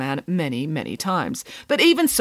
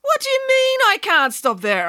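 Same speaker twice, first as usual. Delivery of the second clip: higher, outraged pig nanny; indignant Nanny Piggins. A narrator's reading. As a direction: act offended and affronted. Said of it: "asked Nanny Piggins".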